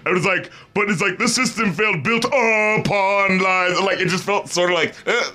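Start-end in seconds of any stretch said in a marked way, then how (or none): 1.2-3.8 very slow "built upon lies" with "built" punched; odd lyric emphasis. Retold or imitated. Mocking the singer's stress for the co-host, slow and heavy. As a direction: lean hard on "built" and drag the rest. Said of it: "put the emphasis on the syllable like that".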